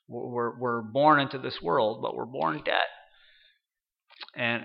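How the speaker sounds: ordinary talk, nothing marked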